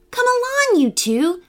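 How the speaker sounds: high-pitched call to her dogs. A narrator's reading. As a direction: call them along, starting high. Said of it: "calling to them".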